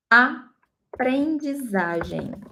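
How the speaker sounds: "aprendizagem" drawn out slowly, ending -zazhen; Portuguese pronunciation. Shown as a demonstration. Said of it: "and in Portuguese, we say".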